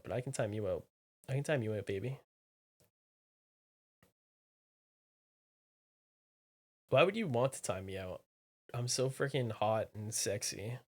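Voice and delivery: soft, low teasing purr; hammed-up sultry taunt; mock seductive.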